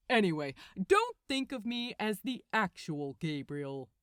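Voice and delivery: loud, exasperated archangel; impatient Gabriel. A narrator's reading. Gabriel telling Crowley he's dreaming, loud and exasperated.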